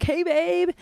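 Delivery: high-pitched, sweet greeting to a partner; cheery greeting. Retold or imitated. Girlfriend greeting her boyfriend by text, acted out cheerily.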